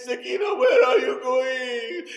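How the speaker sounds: put-on high, loud call after a running child. Aunt calling after the running girl, loud and high. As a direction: call her back, stretched and pleading.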